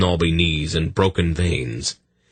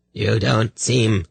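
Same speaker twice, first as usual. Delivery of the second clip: slow, higher, strange rusty voice; rusty growl; 6 semitones higher, slower than usual. Acted. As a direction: growl it slowly from the shadows. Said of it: "a rusty-sounding voice growled".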